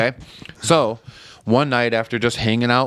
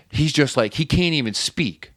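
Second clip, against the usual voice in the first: usual speaking voice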